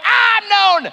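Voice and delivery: hammed-up alarm at being recognised; mock panic. Newcomer realises he's recognised, mock panicked yelp. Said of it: "And I'm like".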